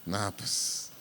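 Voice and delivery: put-on mumbling, evasive childish voice; like La Chilindrina. Pastor mocks children answering like La Chilindrina, mumbled and evasive. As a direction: mumble like a sulky little girl dodging the question. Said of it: "empiezan como la chilindrina".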